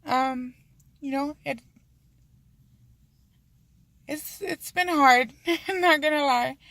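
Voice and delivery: real tears catching in the throat, pushing through; getting emotional. Real, not acted. Telling her viewers about her struggle, voice cracking with tears. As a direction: voice breaking up high, near tears. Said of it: "sorry, I'm getting a little emotional".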